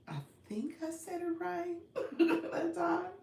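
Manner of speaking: giggling through the words; laughing. Real, not acted. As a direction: laugh while you say it. Laughing at her own mispronunciation.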